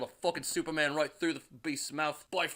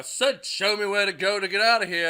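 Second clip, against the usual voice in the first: much louder, higher roaring demand; furious yell; 5 semitones higher, 9 dB louder than usual. Acted. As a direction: blast the repeat at them. Thor repeats demand, yelling.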